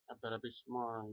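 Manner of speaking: usual speaking voice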